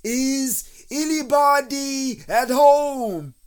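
high, loud, drawn-out call. A narrator's reading. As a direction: holler it slowly into a hole. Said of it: "and called out".

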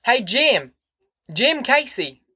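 high, carrying call across the yard. A narrator's reading. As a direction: call him from the porch. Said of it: "he called".

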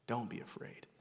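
very soft, hushed close; gentle reassurance. Acted. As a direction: let the angel's words settle quietly. Angel's words, closing gently.

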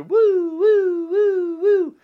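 high, loud mocking hoots; mock wail. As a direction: hoot like a silly crybaby. Mocking cry after quote.

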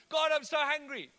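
loud, high, hammed-up moan of hunger; mock hungry whine.

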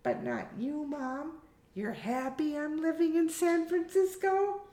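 high-pitched, testing daughter; daughter testing her mother. Acted. Hamish was testing her.

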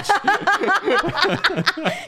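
bursting out laughing, high-pitched; a big cackle at your own joke. Real, not acted.

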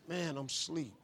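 low and soft, half-awake mumble; sleepy. Retold or imitated. Steve telling John he's asleep, groggy and soft. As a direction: grumble like you were just woken. Woken by phone call.

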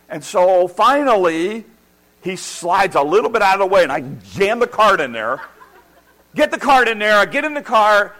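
loud, high-pitched exasperated storytelling; worked-up rant. Retold or imitated. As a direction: build a comic tirade at full volume. Worked-up retelling of the rushed drive.

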